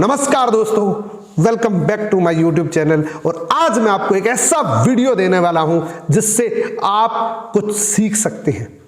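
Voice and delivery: upbeat YouTuber welcome; energetic intro. Shown as a demonstration. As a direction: punch key words with swinging intonation. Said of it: "शब्द दोनों एक ही है।".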